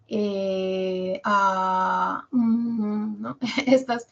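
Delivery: muletillas alargadas en tono plano; tono horizontal. Shown as a demonstration. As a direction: estira "Eh, ah, mm" sin subir ni bajar. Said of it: "tienen un tono horizontal". (tono horizontal, sostenido)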